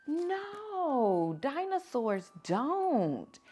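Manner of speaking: emphatic no. A narrator's reading. Emphatic answer to story question.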